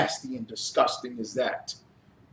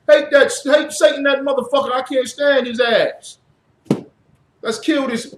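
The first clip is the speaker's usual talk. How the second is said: mock churchgoer rant; 8 semitones higher than usual.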